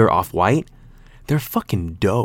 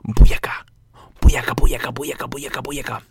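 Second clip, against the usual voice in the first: whispered rhythmic chant; playful whisper; 4 semitones higher, 3 dB louder than usual. Pretend. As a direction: chant it in a loud stage whisper, then let it fade. Playful interjected chant mid-story.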